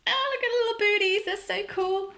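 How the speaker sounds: high, quick gushing over something tiny; excited gush. Real, not acted. Vlogger cooing at the doll's little booties, high and quick. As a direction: coo fast and high over the cute booties.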